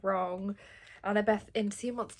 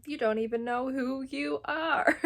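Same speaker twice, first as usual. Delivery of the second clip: "you don't even know who you are" drawn out slowly, pitched up; matched comic timing; 6 semitones higher, 4 dB louder, slower than usual. Acted. Phineas taunting Percy, drawn-out timing on "who you are". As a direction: stretch "who you are" slow and high. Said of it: "the same timing as".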